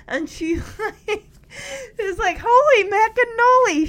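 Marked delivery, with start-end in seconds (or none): 2.4-3.9 loud, high-pitched catchphrase; big mock astonishment; amazed exclamation. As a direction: blurt it out high and loud, imitating a kid. Retells a character's catchphrase exclamation.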